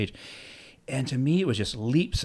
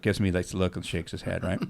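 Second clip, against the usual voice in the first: ordinary delivery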